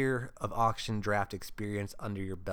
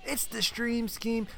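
announcer voice; 10 semitones higher than usual. Acted. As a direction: open the show like a big arena announcer. Podcast host opening announcement.

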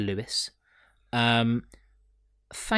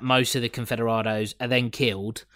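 usual speaking voice

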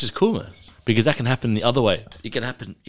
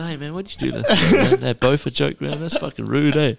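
mock upset; 6 semitones higher than usual. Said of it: "Full upset".

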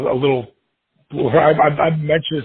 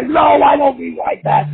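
mock backpedal; 11 semitones higher, 4 dB louder than usual. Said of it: "I'm just busting his chops".

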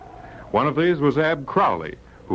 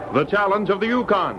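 announcer title; 6 semitones higher than usual. Acted. Radio show title announcement.

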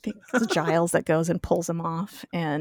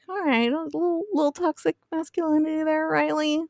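high-pitched, teasing rebuke; mock chiding; 10 semitones higher than usual. Viewer teases Riley about his reaction, mock chiding. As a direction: sing out a wary little reproach. Teller mockingly chides the character.

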